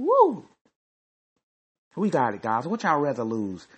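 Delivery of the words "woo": high, loud "woo!"; hyped woo. Real, not acted. Hyped about winning that game.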